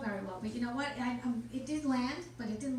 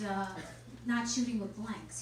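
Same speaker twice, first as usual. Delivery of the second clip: ordinary talk, nothing marked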